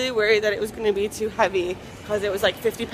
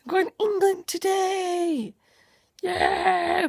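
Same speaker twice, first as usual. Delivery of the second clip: excited; 5 semitones higher, 5 dB louder, slower than usual. Real, not acted. Excited repeat.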